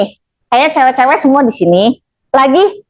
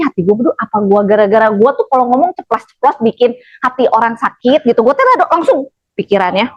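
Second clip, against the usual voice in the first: ordinary talk, nothing marked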